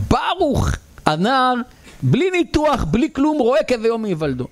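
high-pitched amazed gossip; high, marvelling townsfolk; astonished uproar. Acted. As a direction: spread the incredible news excitedly. Town in uproar over the miracle.

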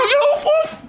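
very high laughing yell; shooing shout. Acted. Parent shooing a child out the door, high laughing yell. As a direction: chase the kid off.

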